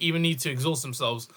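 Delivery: ordinary delivery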